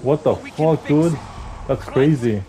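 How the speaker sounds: ordinary talk, nothing marked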